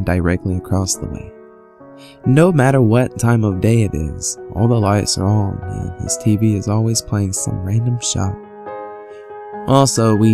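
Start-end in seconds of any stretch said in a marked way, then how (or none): none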